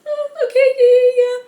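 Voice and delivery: high, loud nervous agreement; flustered high reply. Retold or imitated. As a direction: squeak out a rattled okay-yeah-yeah. Her own flustered reply.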